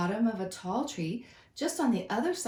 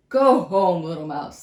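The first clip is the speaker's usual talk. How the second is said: loud, kindly lion voice; good-humoured lion; 4 semitones lower, 10 dB louder than usual. Acted. Lion releases the mouse, loud and good-humoured. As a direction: let the mouse off with a grin. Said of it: "said the lion".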